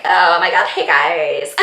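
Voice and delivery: low, put-on pretty-boy greeting; handsome charmer. Vlogger mimicking a handsome long-haired Deadpool, handsome charmer voice. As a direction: play the handsome guy saying hi. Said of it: "like, all handsome and".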